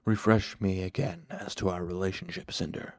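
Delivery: patient tone with a sliver of anger; patient, hidden anger. A narrator's reading. Shadowed man questions his servant, patient with underlying anger. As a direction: keep it patient, dropping off on the name. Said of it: "a deep sliver of anger running through his patient tone".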